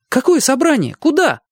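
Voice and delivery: high, urgent shouting; yelled questions. A narrator's reading. Boy pressing the mysterious cook, yelling. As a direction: fire questions at a very high pitch.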